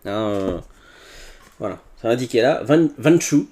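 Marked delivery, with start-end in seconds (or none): 2.6-3.5 French speaker's attempt at an English accent; brand name in a self-mocking English accent. Shown as a demonstration. Vlogger showing off the product range's name, English accent. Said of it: "avec mon bel accent anglais".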